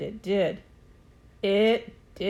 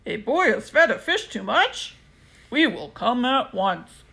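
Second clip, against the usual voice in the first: fireman on the radio; 2 semitones higher, 4 dB louder than usual. A narrator's reading.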